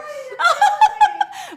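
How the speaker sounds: high loud burst of laughter; embarrassed laugh. Real, not acted. Just ate lunch live on TV.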